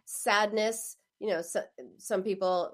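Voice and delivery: normal talking voice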